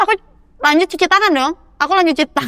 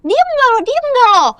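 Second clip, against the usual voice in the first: much higher mock-angry demand; retold furious "shut up" pitched way up; imitating angry shout; 11 semitones higher, slower than usual. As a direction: fire back at her to shut up. Angry woman shouts during fight.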